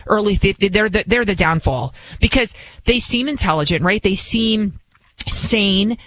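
normal talking voice

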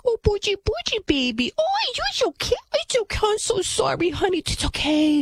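high-pitched mock baby talk; mocking impression of a doting mother; coddling mom. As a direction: gush soothingly in a hammed-up high voice. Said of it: "And the mom is like".

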